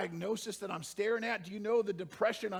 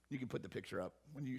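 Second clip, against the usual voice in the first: usual speaking voice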